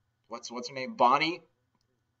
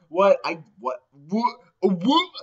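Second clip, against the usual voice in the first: louder puzzled repeated whats; mock confused; 6 semitones higher, 7 dB louder, slower than usual. Visitor baffled by the shout, sputtering confused whats.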